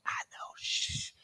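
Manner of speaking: slow shushing whisper; hushed whisper. Retold or imitated. Father hushing his daughter Paisley, slow whisper. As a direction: quietly hush your kid.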